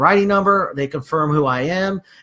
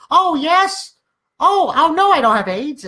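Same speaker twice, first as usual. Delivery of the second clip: high, louder mock senior voice; high-pitched put-on fluster; 11 semitones higher, 3 dB louder, slower than usual. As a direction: imitate a client blurting and backtracking. Voicing flustered seniors screwing up.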